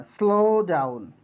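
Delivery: স্লো ডাউন said slowly with both words clear. Shown as a demonstration. Teacher shows students a slow, full স্লো ডাউন. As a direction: draw out স্লো ডাউন, don't drop ডাউন. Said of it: "স্লো না বলে স্লো ডাউন".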